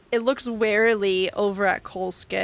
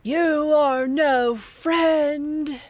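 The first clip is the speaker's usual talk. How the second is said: very slow, higher-pitched monster; wounded creature voice; 6 semitones higher, much slower than usual. Acted. Creature in pain rejecting the party, slow drawn-out monster voice. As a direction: draw out each word as a pained beast. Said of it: "And it says".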